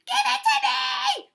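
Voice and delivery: loud, high screamed demand; screaming. A narrator's reading. Turtle demands the bracelet, screaming. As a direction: scream it out in a tantrum. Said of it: "screamed Turtle".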